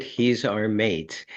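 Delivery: usual speaking voice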